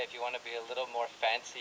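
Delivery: ordinary talk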